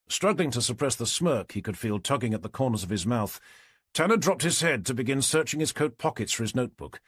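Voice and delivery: ordinary delivery, nothing marked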